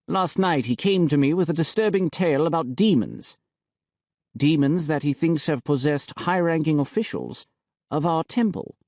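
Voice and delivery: a hint of the bishop, smug and cool; the bishop reporting to the high priest, lightly voiced. Niravati voiced in the story.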